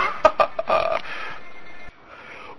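unvoiced laugh; breathy laugh. Real, not acted. Laughs after the bit.

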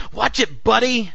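loud, high-pitched warning shout; yelling cabbie. A narrator's reading. Cabbie to the man in the street, yelling. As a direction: yell it like an irate cab driver. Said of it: "The cabbie yelled".

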